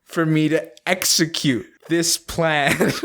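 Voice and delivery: slow, high melodramatic grievance; mock betrayed. Accusing a friend who missed the cue, mock betrayed. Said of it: "you feel almost betrayed by this individual".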